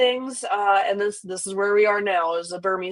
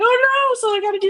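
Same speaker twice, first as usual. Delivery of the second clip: much higher, alarmed oh no; dismayed oh no. Retold or imitated. Noticing the old boa's tail, alarmed oh no. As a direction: squeak it out in sudden worry. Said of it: "It's like".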